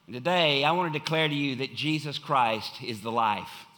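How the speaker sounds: ordinary delivery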